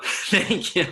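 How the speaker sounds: laughing thanks. Retold or imitated.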